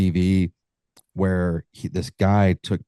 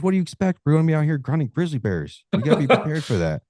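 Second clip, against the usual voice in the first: lightly voiced guide, much higher; a hint of a gruff guide, raised pitch; the guide scolding; 9 semitones higher, faster than usual. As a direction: lecture the client, higher-pitched. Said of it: "He goes".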